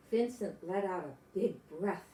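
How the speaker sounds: usual speaking voice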